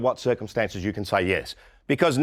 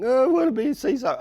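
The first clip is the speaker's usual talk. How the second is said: high, quick whiny mock plea; whinging HR person; 11 semitones higher, faster than usual. HR staff whinging to the boss for a title, high mock whine. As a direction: mimic a petulant staffer begging for a title. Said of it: "the H R people start whinging".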